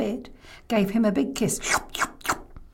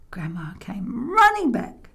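ordinary delivery

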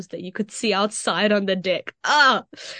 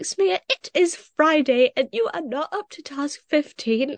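imitating the teacher, pitched higher; mock teacher lecture, higher; stern teacher; 6 semitones higher than usual. Teacher scolding retold.

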